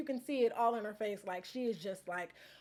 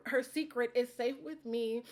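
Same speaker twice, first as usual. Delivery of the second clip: ordinary talk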